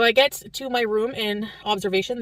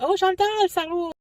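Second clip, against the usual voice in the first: higher, sweet French Canadian lilt on Chantal; French Canadian lady; 11 semitones higher, slower than usual. Retold or imitated. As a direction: imitate a kind old French Canadian volunteer, pitched up. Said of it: "try to imitate it".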